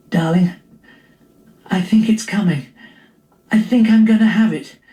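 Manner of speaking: breathy whisper rising to a high urgent cry. Acted. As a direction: wake him gently, then panic as it comes. Wife in labour waking him.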